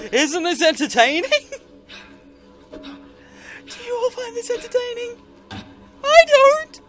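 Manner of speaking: high, strained mock cheer; hammed-up sarcastic cheer. Real, not acted. Mock cheer then protest.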